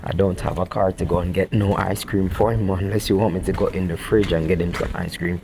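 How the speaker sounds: normal talking voice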